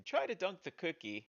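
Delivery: high-pitched game announcer shout; mock shouting. Game maker imitating the game's voice line, mock shouting. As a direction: call it out like a hammed-up game voice. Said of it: "you can hear me shouting at the screen".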